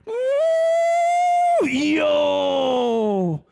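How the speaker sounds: very high, loud sustained whoop; imitated onlooker's drawn-out cheer; excited Israeli whoop. Israeli guy cheering rockets overhead, whooping. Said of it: "some Israeli guy going".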